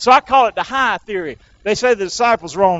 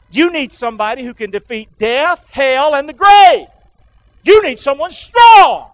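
building preacher's shout; 5 semitones higher, 7 dB louder than usual. Real, not acted. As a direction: build to a loud, high shout on strong. Preacher builds to emphatic climax.

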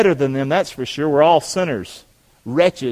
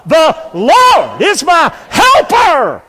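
slow, high, full-force shout; excited shout; 11 semitones higher, 7 dB louder, much slower than usual. Shown as a demonstration. Preacher pushes congregation for one more time, excited shout. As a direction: belt it out word by word to fire them up. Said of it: "I need you to get excited".

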